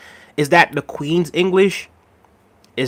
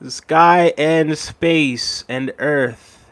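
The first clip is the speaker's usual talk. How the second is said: slow, savoured; much slower than usual. Real, not acted. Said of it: "savouring the comment slowly".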